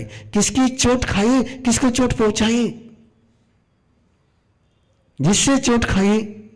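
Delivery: high-pitched, insistent questions; searching self-questioning. Acted. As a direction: press each question high, as if examining yourself. Voices the listener's nightly self-questioning. (ऊँचे सुर में आत्म-मंथन के सवाल)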